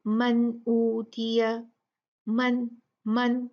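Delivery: मन ऊतिया, slow, न with only a short ghunna; slow मन with light ghunna. Shown as a demonstration. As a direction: hold मन's nasal just a little. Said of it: "बस इतना".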